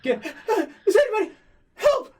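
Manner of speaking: gasping, high-pitched desperate scream; frantic sobbing plea; cry for help. Acted. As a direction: call out in panic, breaking into a loud shriek. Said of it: "that was the help".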